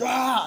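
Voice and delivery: very high, loud scream; mock scream. [name] screams as Michael grabs him, mock scream. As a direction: shriek as if suddenly grabbed.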